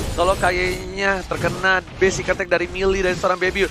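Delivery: usual speaking voice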